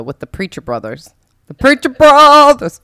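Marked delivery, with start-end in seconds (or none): 1.5-2.8 loud, slow, drawn-out repeat; emphatic shout. Real, not acted. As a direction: call the name out big.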